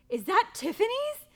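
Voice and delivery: delighted disbelief at the ring box; thrilled surprise. Retold or imitated. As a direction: squeal at the brand on the box.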